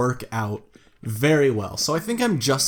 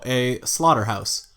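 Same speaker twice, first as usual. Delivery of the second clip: ordinary talk, nothing marked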